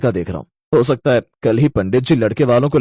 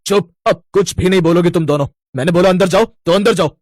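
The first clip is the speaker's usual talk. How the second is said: angry scolding; 6 semitones higher than usual. Acted. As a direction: order them inside firmly. Brother scolds fighting sisters. (गुस्से में ऊँची आवाज़ में डाँटना)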